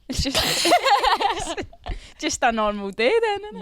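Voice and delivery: laughing through the words. Real, not acted.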